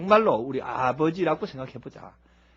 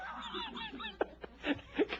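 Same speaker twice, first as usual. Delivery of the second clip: high fading laugh; 10 dB quieter than usual. Real, not acted. Laughing mid-quote.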